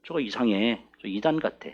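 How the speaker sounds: low, hushed mock gossip; suspicious aside. Church members whispering about a suspected heretic, suspicious. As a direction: drop right down as if confiding a suspicion.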